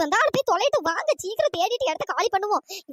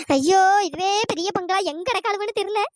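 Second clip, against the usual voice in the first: ordinary delivery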